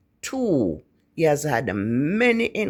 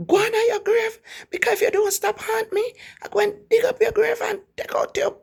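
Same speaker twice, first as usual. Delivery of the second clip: angry Jamaican threats; faster than usual. Retold or imitated. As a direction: threaten the haunting spirit in Jamaican patois. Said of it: "he would use some expletive and say".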